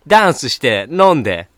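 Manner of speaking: loud party-hype voice; gyaru speech. Acted. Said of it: "ヒップホッパー". (大声でノリノリのパーティー口調)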